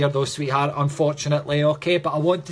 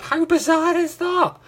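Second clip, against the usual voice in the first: amazed; 13 semitones higher, 3 dB louder than usual. Real, not acted. Key confirmation from viewer.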